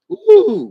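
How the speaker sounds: high, loud pleased ooh; high loud ooh about nice meds; woozy ooh. Real, not acted. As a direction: let out a giddy ooh. Stoned, enjoying the meds.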